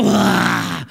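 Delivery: loud, bluffing "ah" roar; mock devil roar. Preacher imitating the devil trying to scare believers, mock roar. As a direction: let out a big empty scare noise. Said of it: "Next time when the devil go".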